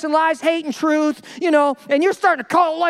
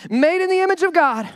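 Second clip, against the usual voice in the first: normal talking voice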